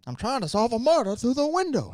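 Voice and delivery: mock the hero's excuse; mock movie hero. Friend mocks the Rear Window hero in a high voice.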